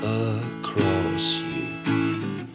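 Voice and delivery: ordinary delivery, nothing marked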